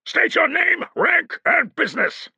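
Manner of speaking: brusque guard demand; snapped order. A narrator's reading. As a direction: snap it at him like a bored guard. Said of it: "snapped one".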